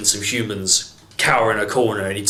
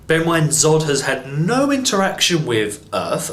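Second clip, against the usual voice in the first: normal talking voice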